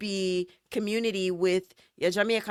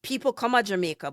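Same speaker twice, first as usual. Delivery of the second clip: ordinary talk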